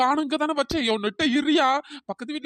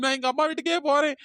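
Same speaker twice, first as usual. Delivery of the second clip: usual speaking voice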